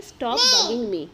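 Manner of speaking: loud annoyed cry, drawn out slowly; fed-up yell; irritated shout. Acted. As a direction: snap it out loud to make them stop. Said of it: "And you can say".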